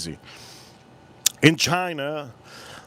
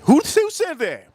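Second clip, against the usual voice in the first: surprised; 13 semitones higher, 4 dB louder, slower than usual. Real, not acted. Reacts to chat comment.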